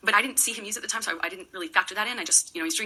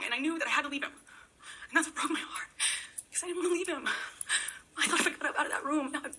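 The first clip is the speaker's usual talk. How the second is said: fighting back tears as she remembers; real emotion breaking through, higher and tight; close to tears; 5 semitones higher than usual. Real, not acted. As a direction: voice pitched up and strained, on the edge of crying. Recalling painful breakup, voice strained.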